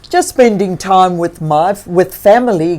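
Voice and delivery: thankful bear talking to himself; grizzly bear voice. Acted.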